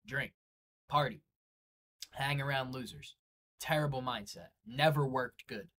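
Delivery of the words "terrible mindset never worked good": ordinary talk, nothing marked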